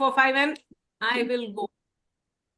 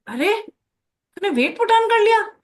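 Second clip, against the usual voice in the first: much higher, louder, fast catty remark; mock judgmental guest; 9 semitones higher, 6 dB louder, faster than usual. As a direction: tease someone about weight gain at a party. Judging someone at a party.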